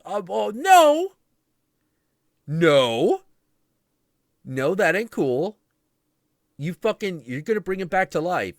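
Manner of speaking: high, loud, slow protest. Retold or imitated. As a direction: insist loudly. Gods refusing.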